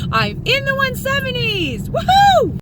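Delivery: very high, jubilant squeal rising to a loud whoop; excited celebration. Real, not acted. Vlogger telling somebody about the one seventies, jubilant. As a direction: crow it high and end on a whoop. Celebrates reaching her weight goal.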